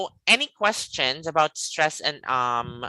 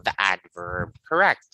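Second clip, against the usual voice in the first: ordinary talk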